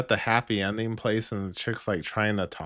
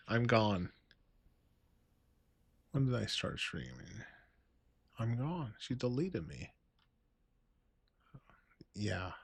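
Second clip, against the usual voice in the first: quiet dejected mutter; 11 dB quieter than usual. Real, not acted. Comment deleted, fading away.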